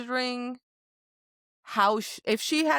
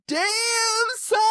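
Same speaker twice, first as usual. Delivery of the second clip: rejoicing; 13 semitones higher than usual. Retold or imitated. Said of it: "I rejoiced and was like".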